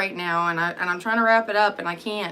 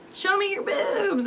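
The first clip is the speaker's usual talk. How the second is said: high, slow, laughing mock girl; 11 semitones higher, slower than usual. Imitating girls in her chat, high, slow and laughing. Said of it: "most girls are just gonna be like".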